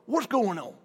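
dad's country accent, lightly voiced. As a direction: imitate a country father checking in. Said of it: "My dad's kinda country".

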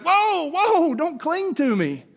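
high, loud whoa, pulling back; playful alarmed voice; startled Jesus. Retold or imitated. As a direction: fend off a bear hug, laughing. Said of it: "he's like".

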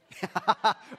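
short loud laugh; real amused laugh. Real, not acted. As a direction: burst out laughing at your own joke. Laughs at own gross illustration.

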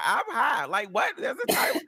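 high-pitched mock stoned voice; high friend imitated. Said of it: "She's like".

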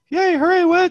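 very high squeaky chant; squeaky cheer. Pretend. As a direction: squeal the award name like a cheer. Chanting the award name.

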